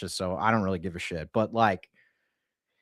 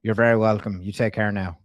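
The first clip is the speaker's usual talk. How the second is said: polite Irish sign-off; Irish accent. Pretend. Prank caller thanking a staffer goodbye, Irish accent. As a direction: say goodbye in a put-on Irish twang. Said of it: "she really liked my Irish accent".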